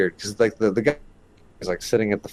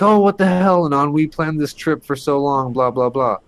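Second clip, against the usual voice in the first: flipping out; 5 semitones higher than usual. Retold or imitated. Friend protesting the cancelled camping trip, mock flipping out. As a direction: imitate an upset friend, starting high and tapering off. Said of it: "flipping out like".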